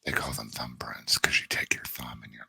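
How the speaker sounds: whispered seductive description; sultry phone sex voice. Pretend. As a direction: breathe the words into the mic. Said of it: "okay, I can't even do this".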